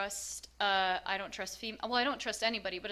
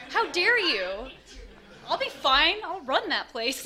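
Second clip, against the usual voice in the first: higher and louder, huffy and laughing; mock indignant; 7 semitones higher, 8 dB louder than usual. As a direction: play up being offended as a joke. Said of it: "I took that as an insult".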